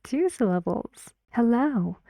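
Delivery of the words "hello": "Hello" with a high, stressed "lo"; quick light "He", then lean on "lo" in "Hello"; stress on second syllable. Shown as a demonstration. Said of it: "the stress is on the second syllable".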